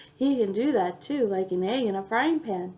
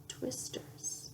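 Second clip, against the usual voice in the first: whispered; 18 dB quieter than usual. Acted. Reading to a child, a whispered ending. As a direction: whisper the last word.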